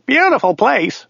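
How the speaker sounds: very high-pitched driver voice; driver character. A narrator's reading. Driver confusing countries with his passenger, very high character voice.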